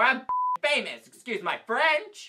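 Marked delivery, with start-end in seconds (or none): none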